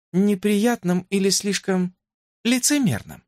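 shy princess. A narrator's reading. Princess voiced by narrator.

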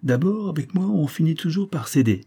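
put-on high-pitched artless girl; lightly voiced naive girl, pitched well up; ingenuous. A narrator's reading. Girl explaining that everyone gives in to her, ingenuous. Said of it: "reprit ingénument Antoinette".

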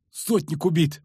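high, despairing groan; moaned. A narrator's reading. Another soldier reports the captain dead, moaning. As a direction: moan out the bad news. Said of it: "простонал другой".

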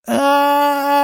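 high, drawn-out furious howl; howl of helpless rage. A narrator's reading. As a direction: howl out in powerless fury. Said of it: "взвыл Дикобраз в бессильной ярости".

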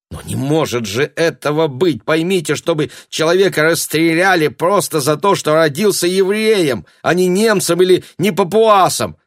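high, loud, heated protest; indignant disbelief. Acted. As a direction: argue passionately that it cannot be. Incredulous protest at dreadful news.

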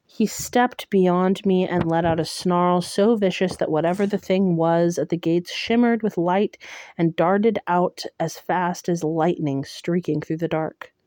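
ordinary delivery, nothing marked

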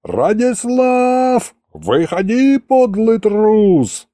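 high, slow, drawn-out taunting shout; yelling challenge. A narrator's reading. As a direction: bellow the challenge with stretched vowels. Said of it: "снова заорал я". (орёт, вызывая на бой)

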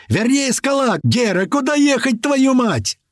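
high, frantic strained yell. A narrator's reading. Driver demanding a route from Gera, yelling. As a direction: yell at the very top of the pitch range. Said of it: "заорал Рыжий".

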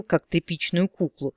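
usual speaking voice

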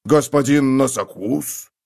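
slow, drawn-out sneering courtesy; bow and greet them with scorn; a young guard mocking arrested fake nobles. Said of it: "насмешливо поприветствовал он гостей".

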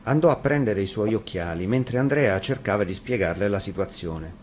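plain reading voice

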